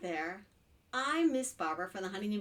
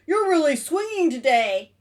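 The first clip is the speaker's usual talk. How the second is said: loud, raised-pitch shout to a buddy; lizard calling out; 6 semitones higher, 12 dB louder than usual. Acted. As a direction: holler a compliment across the branches. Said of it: "said the lizard".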